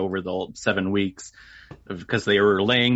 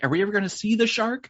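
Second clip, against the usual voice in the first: put-on childlike wondering; 11 semitones higher, faster than usual. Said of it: "Kinda like how, like".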